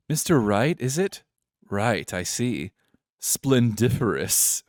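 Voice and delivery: badly faked posh British grandeur. Pompous CEO sizing up the lawyer, posh British. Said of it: "Posh British".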